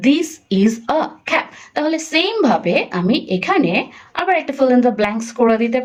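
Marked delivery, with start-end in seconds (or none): 0.1-1.5 each word drawn out; slow reading demo. Shown as a demonstration. Teacher modelling how the child reads the sentence. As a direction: read "this is a cap" very slowly. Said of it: "সে রিডিংও করতে পারলো".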